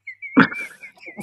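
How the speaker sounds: squealed high laugh; high giggle. Real, not acted. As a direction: burst into a giggle. Laughing at own line.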